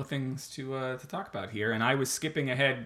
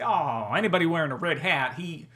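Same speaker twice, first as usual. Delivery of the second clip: mock Southern bumpkin, pitched up; Southern accent; 5 semitones higher than usual. Host mimicking red-hat fans, Southern accent. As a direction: put on a dim Southern voice. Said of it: "Why are they always Southern?".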